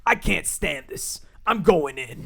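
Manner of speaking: slow, dramatic fed-up TV host; John Taffer. Retold or imitated. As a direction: imitate a host who has had enough, drawn out and forceful. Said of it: "in typical John Taffer fashion".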